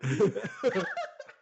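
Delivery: a burst of open laughter; real laugh. Real, not acted. Cracking up at the story told to a friend, real laugh.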